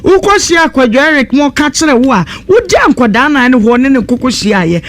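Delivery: ordinary talk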